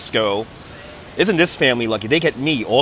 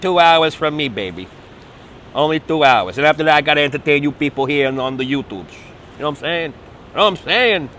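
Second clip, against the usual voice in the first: lightly put-on street accent; a hint of a funny accent. Guide joking to his YouTube viewers in a funny accent. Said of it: "like my funny accents there".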